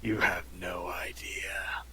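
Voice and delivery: slow whispered edgelord line; brooding fake-deep mutter. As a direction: whisper it darkly, slow and low-key. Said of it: "it goes even deeper".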